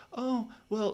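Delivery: oily salesman backpedal; phony salesman. Retold or imitated. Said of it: "back to the manipulative, recruiting, phony salesman".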